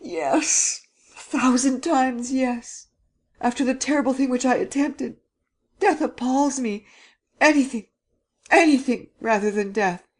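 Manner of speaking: high, desperate begging; desperate terror. Acted. Terrified young man begs the prince to spare him, high and desperate. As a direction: plead high and breathless with dread.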